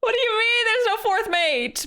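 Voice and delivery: very high amused whine, sliding down; mock-distressed wail. Real, not acted. Streamer finding the guess is impossible, high protesting wail. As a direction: wail in laughing disbelief. Laughing protest at own mistake.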